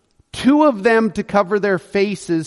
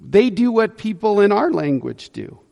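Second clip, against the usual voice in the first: normal talking voice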